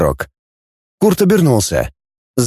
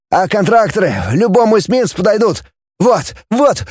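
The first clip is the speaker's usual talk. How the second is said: high-voiced eager explainer; excited scientist; 6 semitones higher than usual. A narrator's reading. Scientist explains contraction to the commander, eager and high. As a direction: explain the invention with rising excitement.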